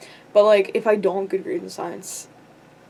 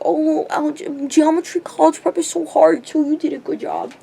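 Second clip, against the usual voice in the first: higher, sing-song mock reassurance; mock consoling voice; 6 semitones higher than usual. Mimicking people who praise her geometry grade, high mock consolation. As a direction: parody their patronising comfort.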